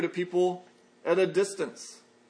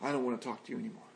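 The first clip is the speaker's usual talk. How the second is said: sulky brush-off; 8 semitones lower, 12 dB quieter, much faster than usual. Retold or imitated. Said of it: "respond like the Galatians".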